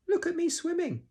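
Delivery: put-on very high, loud childlike squeak; Roo squeaking. A narrator's reading. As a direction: squeak it out proudly. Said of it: "squeaked Roo".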